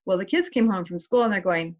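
usual speaking voice

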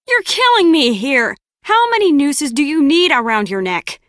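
fed up and letting it out; loud exasperated outburst. Acted. Acted exasperated scolding.

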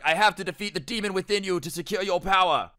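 strained, overdramatic power voice; mock epic warrior. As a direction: declare it like a cartoon hero. Acting Eric in force battle.

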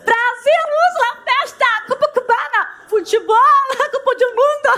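a giggling carnival Brazilian caricature; mock Brazilian. Teacher parodying Brazilians for the class, laughing and high. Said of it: "les Portugais, c'est la même chose".

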